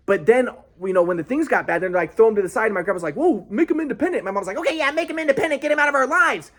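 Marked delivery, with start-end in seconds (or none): 3.1-4.2 high-pitched mocking imitation of grandpa. Voicing grandpa in the retold quarrel. 4.6-6.5 high-pitched, put-on imitation of mom; mock mom voice. Voicing mom agreeing, mock high voice.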